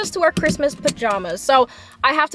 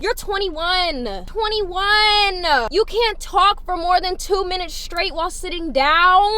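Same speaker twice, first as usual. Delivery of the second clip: mock self-scolding; 6 semitones higher, 3 dB louder, slower than usual. Scolding herself for breathlessness.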